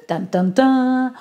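slow mock suspense "dun, dun, dun"; dramatic sting. Teasing listeners about the next assignment, mock suspense. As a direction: hum a spooky suspense sting.

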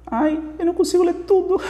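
high-pitched whine breaking into laughter; real amused frustration. Real, not acted. Teacher unable to read the whole student question, laughing exasperation. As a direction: squeal it helplessly, then laugh.

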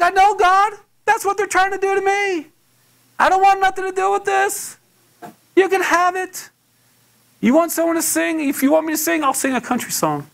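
high-pitched, heated arguing with God; intense protest. Retold or imitated. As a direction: push back at God in a high, strained outburst. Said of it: "it was pretty intense between me and God".

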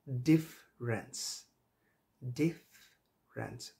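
ডিফারেন্স said slowly, syllable by syllable, as a model; drawn-out ডিফারেন্স with a falling end; slow model word. Shown as a demonstration. Teacher slowly modelling ডিফারেন্স for students. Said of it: "তো শব্দটা হচ্ছে কি?".

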